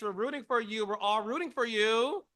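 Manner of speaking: Tyra Banks. Retold or imitated. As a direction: raise the pitch and plead it, mock-dramatic. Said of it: "in the words of Tyra Banks".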